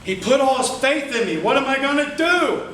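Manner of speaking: ordinary delivery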